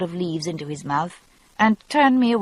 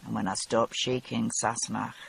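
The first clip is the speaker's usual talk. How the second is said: quiet, lower Scots voice, close and gentle; said quietly; 8 semitones lower, 8 dB quieter than usual. A narrator's reading. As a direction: lower and softer, a quiet Highland man. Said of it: "he said quietly".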